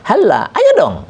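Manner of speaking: high, pressing halla with force; strong urging. Shown as a demonstration. Teacher shows the strong tone of halla. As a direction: demand it, no softness. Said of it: "memberikan intonasi yang, yang kuat".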